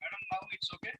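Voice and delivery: faint and feeble voice; weak voice, hard to hear; barely audible. Real, not acted. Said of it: "Very feeble".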